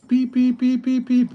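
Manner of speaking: high, even string of electronic beeps; phone beeping. Retold or imitated. Phone going off with league chat, high mimicked beeps. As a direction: imitate a phone beeping nonstop. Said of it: "My phone just is like".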